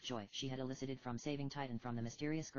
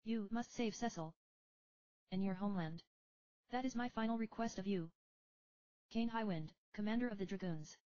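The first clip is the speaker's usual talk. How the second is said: sad; 8 semitones higher than usual. Acted. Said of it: "sadness permeating his voice".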